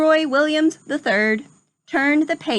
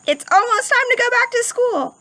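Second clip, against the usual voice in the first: excited; 11 semitones higher than usual. Real, not acted. Teacher excited intro to class.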